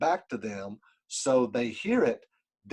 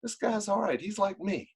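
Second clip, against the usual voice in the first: inner thought voice; 9 semitones higher, 4 dB quieter than usual. Retold or imitated. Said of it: "But what they do know is".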